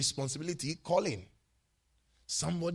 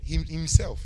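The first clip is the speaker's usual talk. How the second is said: Him, himself keras dan ditekan; stress on himself; 12 dB louder, slower than usual. Real, not acted. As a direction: pukul kata himself dengan keras. Preacher stressing the word.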